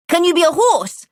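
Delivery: put-on a small boy thrilled by a game; eager little boy. A narrator's reading.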